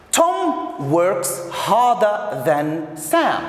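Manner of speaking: slow, spaced-out example sentence; slow model reading. Shown as a demonstration. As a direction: give each word room for learners.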